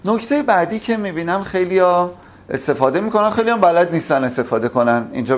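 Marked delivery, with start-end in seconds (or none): none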